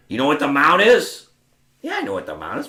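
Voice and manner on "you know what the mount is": loud, higher-pitched testing question; impatient challenge. Retold or imitated. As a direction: imitate a gruff coach challenging a student. Tony challenges him in retold exchange.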